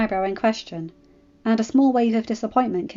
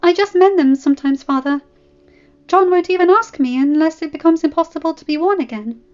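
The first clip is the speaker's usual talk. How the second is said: put-on high, pleading young girl; anxious girl tumbling out excuses; scared girl; 9 semitones higher, 8 dB louder than usual. A narrator's reading. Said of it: "Sansa was scared".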